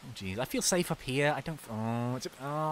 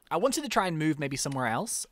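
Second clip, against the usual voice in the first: usual speaking voice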